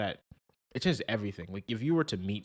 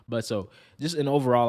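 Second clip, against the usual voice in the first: ordinary talk, nothing marked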